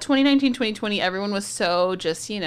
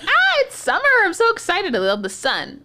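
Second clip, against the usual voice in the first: hammed-up summer joy; mock excitement; 3 dB louder than usual. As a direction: gush like a sun lover, pitched way up. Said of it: "But I was like".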